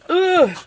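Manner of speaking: high exasperated ugh; loud groan. Real, not acted. Crafter groaning at duplicate supplies, mock despair.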